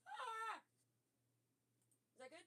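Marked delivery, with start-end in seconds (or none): 0.1-0.6 short loud panicked yelps; panicked yell. Real, not acted. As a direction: yell out in alarm. Panics that stream audio is quiet.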